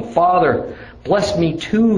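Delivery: high, pleading cry to his father. Acted. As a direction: beg your father, voice rising. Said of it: "he begged".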